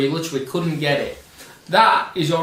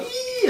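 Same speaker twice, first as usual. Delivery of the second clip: excited squeal. Retold or imitated.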